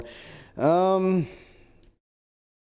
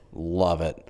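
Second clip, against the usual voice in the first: ordinary talk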